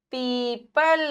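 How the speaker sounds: पीपल with a long held ee; long drawn vowel. Shown as a demonstration. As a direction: draw out both syllables of पीपल. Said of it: "pause लेते हैं". (पीपल में ई को खींचकर बोलें)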